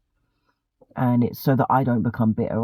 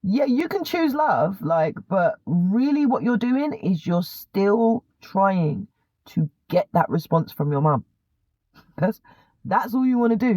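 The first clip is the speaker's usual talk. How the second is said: lecturing cousin; 7 semitones higher than usual. Retold or imitated. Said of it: "And she was like".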